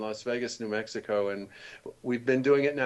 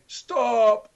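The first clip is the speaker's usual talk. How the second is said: sharp high call of stop, louder; mock studio engineer barking a cue; engineer's command; 10 semitones higher, 8 dB louder than usual. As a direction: imitate the sound engineer calling a halt. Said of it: "And then he would say".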